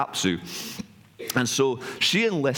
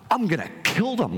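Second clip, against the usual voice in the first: mock-angry threat, pitched higher; retold fury; 7 semitones higher than usual. Enraged god to his lover about their kids, retold mock fury. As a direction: act out a god losing his mind, without shouting. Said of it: "he starts to lose his mind".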